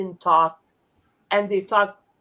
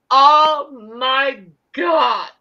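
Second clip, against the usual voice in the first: slow, loud "Oh my God" in sudden surprise; drawn-out loud gasp of shock; shocked exclamation; 7 dB louder, much slower than usual. Retold or imitated. Hearing the coach on TV, shocked and loud. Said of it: "I said".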